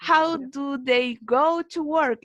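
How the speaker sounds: slow, carefully spaced English question; slow model reading. Shown as a demonstration. Teacher re-reading a tricky question for the student, slow. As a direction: read it slowly so it is easy to follow. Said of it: "Y de verdad cuesta leerlo".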